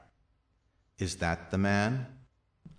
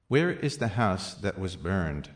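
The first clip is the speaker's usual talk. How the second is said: ordinary talk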